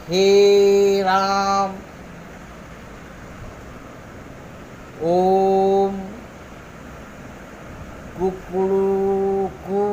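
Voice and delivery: हे राम stretched long as if calling from afar; drawn-out pluta call. Shown as a demonstration. Teacher calling out to someone far away, long drawn vowels. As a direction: hold the last vowel of ओम and कुकड़ू कु for three beats. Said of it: "किसी को दूर से बुलाने के लिए".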